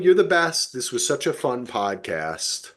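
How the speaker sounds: ordinary talk, nothing marked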